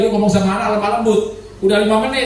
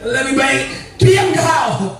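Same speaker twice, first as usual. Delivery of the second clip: mock retort; 7 semitones higher, slower than usual. Mock retort, high and slow.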